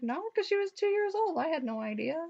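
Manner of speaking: lightly voiced high-pitched mother; mother's protesting reply. Mother answering her friend's question, in a lightly imitated high voice. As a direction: pitch it up high, then ease down.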